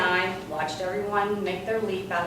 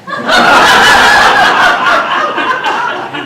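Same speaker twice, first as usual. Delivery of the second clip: high airless laugh; breathy burst of laughter; 17 dB louder than usual. Real, not acted. Laughing at the bald man's line. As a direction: let out a wheezing laugh.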